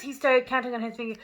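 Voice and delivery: plain reading voice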